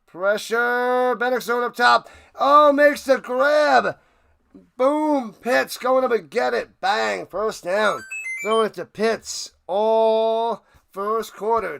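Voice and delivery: high, loud, excited game call; play-by-play call. Acted. Streamer calls the Falcons drive live, excited play-by-play.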